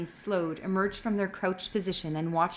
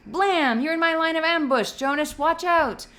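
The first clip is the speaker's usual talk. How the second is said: excited shouting; 9 semitones higher, 6 dB louder than usual. A narrator's reading. Asher shouts during war game.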